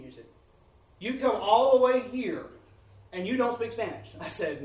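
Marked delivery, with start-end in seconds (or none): 1.0-4.1 incredulous question. Retold or imitated. Local brother shocked that the visiting preacher speaks no Spanish, loud disbelief.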